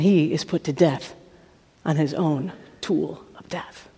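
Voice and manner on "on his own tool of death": dark hush; whispered ending. A narrator's reading. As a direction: drop to a whisper. Execution told darkly.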